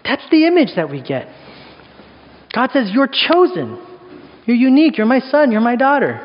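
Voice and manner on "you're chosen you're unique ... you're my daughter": affirming God voice. Retold or imitated. God speaks to his children, earnest affirmation. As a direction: proclaim it like a proud father.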